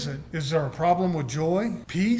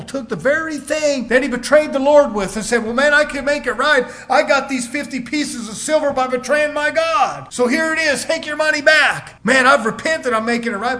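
loud, high mock plea of Judas; Judas pleading; 7 semitones higher, 5 dB louder than usual. Preacher voicing Judas at the temple, loud and high. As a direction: play Judas insisting he made it right.

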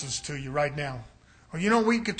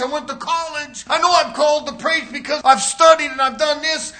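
higher and loud, boasting; self-assured preacher; 8 semitones higher, 7 dB louder than usual. Acted. Preacher mocking proud preachers who boast of their calling, loud and higher. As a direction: insist on your calling, puffed up. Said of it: "they say".

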